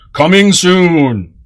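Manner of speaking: slow, booming trailer announcer; movie theater announcer voice. Shown as a demonstration. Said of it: "my movie theater announcer voice".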